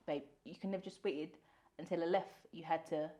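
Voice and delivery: lightly imitated British accent. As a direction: quietly put on a proper British voice. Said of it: "a very British accent".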